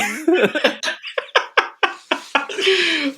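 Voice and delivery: a high, wheezing laugh that breaks loose; high burst of laughter. Real, not acted. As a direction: laugh hard at your own story, way up high. Bursting out after telling the story.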